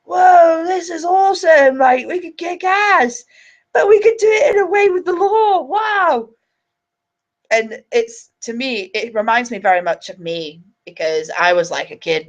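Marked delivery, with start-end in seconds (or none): 0.0-6.3 high-pitched wide-eyed amazement, imitated; glued, awestruck lad. Young lad at the legal briefing realising they can act, imitated awestruck excitement. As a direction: gush like a thrilled newcomer. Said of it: "this guy was glued. He was like".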